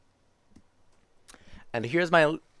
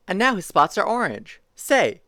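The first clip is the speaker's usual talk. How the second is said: lively picture-book reading voice; reading to children; 3 semitones higher than usual. Acted. Streamer reading a zoo picture book to chat, bouncy storytime voice. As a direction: read it like storytime for little kids.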